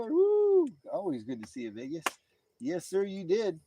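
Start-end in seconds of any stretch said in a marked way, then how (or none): 0.0-2.0 high, whooping welcome, loud at first; whoop, then greet them in a high voice; excited greeting. Real, not acted. Streamer greets Vegas arriving in the chat, excited.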